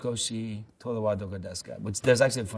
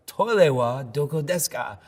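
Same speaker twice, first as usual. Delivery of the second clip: 'Toilet wa doko desu ka?' said louder and higher, projected to the room; louder repeat; 5 semitones higher, 6 dB louder than usual. Retold or imitated. Co-star repeating it to the live TV crowd, louder and higher. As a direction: belt out Toilet wa doko desu ka to the whole crowd. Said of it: "he does it louder".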